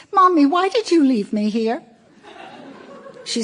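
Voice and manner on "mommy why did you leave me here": put-on high, pleading little boy calling Mommy; a small child calling out for his mother; small child's voice. A narrator's reading. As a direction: reader voicing a young son on the phone, high and plaintive. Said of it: "Sammy's small voice says".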